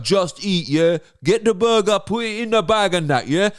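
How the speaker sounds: punchy exaggerated London street accent; mock street accent. Advert selling burgers, mock street accent. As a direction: imitate an urban youth accent in an advert. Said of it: "to speak like this".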